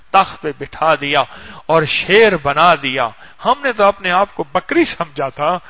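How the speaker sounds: ordinary delivery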